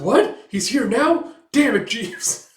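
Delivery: loud high shriek of alarm; shocked Melinda. Acted. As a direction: panic at the news. Melinda shocked son is here.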